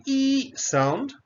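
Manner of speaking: E held long and high before SOUND. Shown as a demonstration. Teacher shows the vowel of brief and field, E held long and high. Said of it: "the pronunciation is the E".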